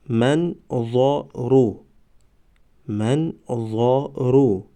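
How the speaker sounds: slow, syllables held and separated; slow syllable reading. Shown as a demonstration. Teacher models first readings for learners, slow syllable by syllable. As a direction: sound it out for learners, pausing between syllables. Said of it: "بار یکم و دویم آهسته".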